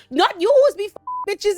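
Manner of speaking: high, loud accusation; hammed-up high-pitched scolding; mock quarrel. Accusing a lover, high loud mock outrage.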